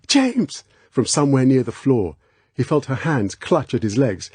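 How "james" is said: high cry of his name. Acted. Girl crying out to Bond from near the floor, high cry. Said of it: "He heard her cry".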